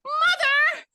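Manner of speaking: very high, loud shriek of "mother"; imitated horrified shriek; mock scream. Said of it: "And she's like".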